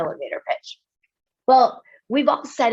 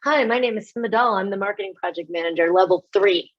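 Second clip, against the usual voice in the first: fast, rattled-off job title intro; rushed mock introduction; faster than usual. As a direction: reel off the title like a bored new hire. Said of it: "somebody comes around".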